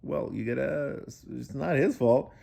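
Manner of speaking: ordinary delivery, nothing marked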